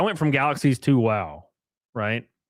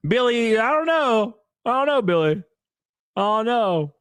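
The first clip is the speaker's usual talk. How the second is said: higher, singsong shrug; mock helpless; 10 semitones higher than usual. Answering chatter Billy, high mock-helpless shrug.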